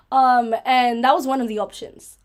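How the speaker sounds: ordinary delivery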